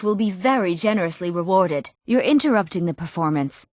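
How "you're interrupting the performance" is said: ordinary talk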